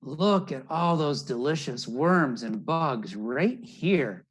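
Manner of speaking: ordinary delivery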